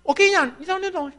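usual speaking voice

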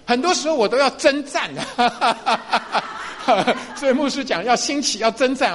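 chuckling at his own plight; laughing. Real, not acted. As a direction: laugh through the admission. Laughing about being only male pastor.

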